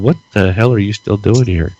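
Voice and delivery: ordinary talk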